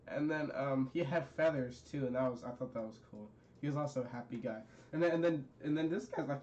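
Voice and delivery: ordinary delivery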